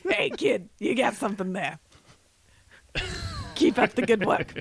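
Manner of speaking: lightly voiced old producer; a hint of a kindly old mogul; old Hollywood producer. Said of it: "Old Hollywood producer".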